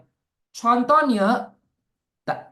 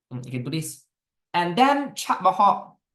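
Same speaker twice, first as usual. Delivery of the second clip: ordinary talk, nothing marked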